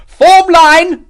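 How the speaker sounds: very high, loud drawn-out command; shouted order. A narrator's reading. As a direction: bellow the order to your men under fire. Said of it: "Morris shouted".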